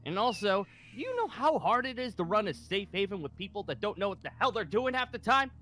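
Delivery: angry. Acted. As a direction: vent angrily in self-defence. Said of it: "So you sound angry".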